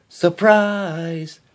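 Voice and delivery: spring the surprise on her; cried surprise. A narrator's reading. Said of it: "her friends cried".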